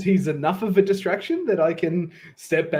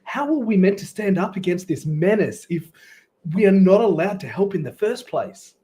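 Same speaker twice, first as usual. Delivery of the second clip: aggrieved adventurer in character; 6 semitones higher, 3 dB louder than usual. Acted.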